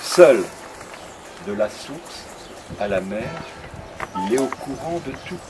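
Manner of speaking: slow, measured reading with long pauses; poem recitation. Acted. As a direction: savour each line of your own verse. Recites his poem on tour.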